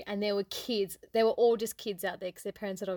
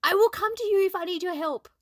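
higher and louder, exasperated; irritated retort, pitched up and louder; frustrated outburst; 8 semitones higher, 6 dB louder than usual. Retold or imitated. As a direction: snap it out at mum in frustration. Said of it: "It's so frustrating".

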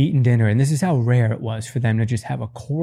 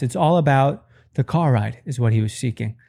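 ordinary delivery

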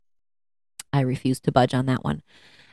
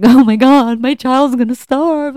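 hammed-up panic, much higher and louder; mock panic; 10 semitones higher, 7 dB louder than usual. New mom over spilled milk, mock panic. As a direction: play the frantic new mom, overdoing it. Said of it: "it was so dramatic about everything".

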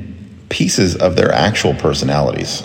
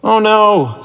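sad; 3 dB louder than usual. Acted. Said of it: "said Jonathan sadly".

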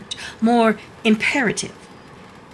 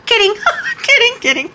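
high, giggly retraction; tittering, teasing take-back; laughing it off; 12 semitones higher, 4 dB louder, faster than usual. Acted. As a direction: laugh between the words. Laughs off her own mock drama.

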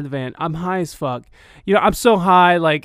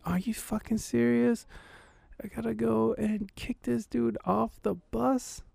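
high, hushed, stunned disbelief; can't believe what she's asking, said under his breath; incredulous disbelief; 7 semitones higher, 10 dB quieter than usual. Retold or imitated. Narrator to girlfriend, asked to kick his friend out: hushed, high, incredulous.

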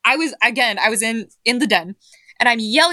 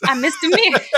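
pitched-up mock Jersey accent; Mister Mears; 5 semitones higher than usual. Quoting a favorite character, attempted Jersey accent. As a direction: echo it in a rough Jersey accent. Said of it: "I can't quite do the Jersey accent".

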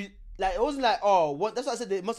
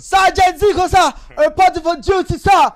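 high, loud barking soldier; stiff barking toy soldier; 12 semitones higher, 15 dB louder, slower than usual. Pretend. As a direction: bark it at attention. Playing soldier reporting for duty.

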